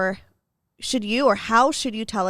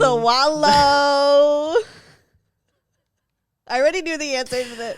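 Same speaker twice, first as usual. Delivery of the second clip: tipsy; 5 semitones higher, 8 dB louder, slower than usual. Real, not acted. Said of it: "I'm, like, a little tipsy".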